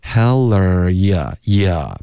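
"Heller ya ya" drawn out slowly with no r-colouring; very slow "Heller ya ya" with no er sound; slow demo. Shown as a demonstration. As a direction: model "Heller ya ya" slowly, keeping the ya clean. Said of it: "不要不知不觉导致儿化音".

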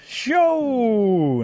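high, long, loud held call; insistent holler. A narrator's reading. Rabbit calling the creature rude, then hollering the greeting. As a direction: holler it and hold the note.

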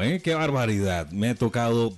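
usual speaking voice

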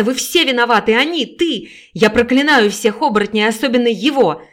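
loud, hoarse curse; cursing scream. Acted. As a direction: curse them all at full voice. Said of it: "захрипела женщина". (хриплое проклятие во весь голос)

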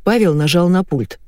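plain reading voice

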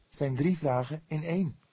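shy, coy reply; lightly blushing young man. A narrator's reading. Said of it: "zei hij licht blozend".

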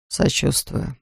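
soft, almost whispered sympathy; quiet. A narrator's reading. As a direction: barely voice it.

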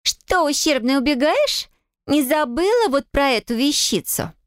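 high, mocking bratty girl voice; sneering taunt. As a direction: taunt her like a spoiled sister. Sneering sister taunts heroine.

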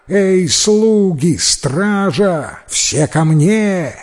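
high, drawn-out summons; loud call. A narrator's reading. Wife summoning servants and guards, high calling cry. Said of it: "громко закричала".

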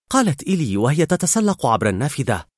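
plain reading voice

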